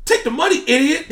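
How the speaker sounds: high, loud yell of frustration; exasperated yell. Real, not acted. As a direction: shout it at the fool who picked dinner. Yelling advice at [name] fan.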